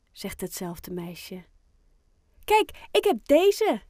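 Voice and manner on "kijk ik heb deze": proud. A narrator's reading. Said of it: "Trots laat ze".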